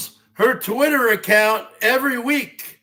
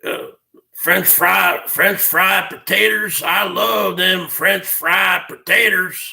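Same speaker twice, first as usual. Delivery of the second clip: Billy Bob Thornton impression; 6 semitones lower than usual. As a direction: sink way down into a mumbling backwoods Sling Blade voice. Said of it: "like Billy Bob Thornton from Sling Blade".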